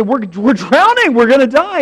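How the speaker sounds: hammed-up frantic warning; panicked cry. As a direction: blurt it out in mock panic. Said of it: "and they say".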